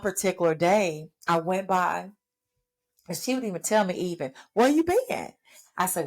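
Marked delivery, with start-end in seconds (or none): 4.5-5.3 high mock old grandma asking; voicing her grandmother. Her grandmother greeting her at the bedside, high and lively. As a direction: pitch it up as the spunky old grandmother.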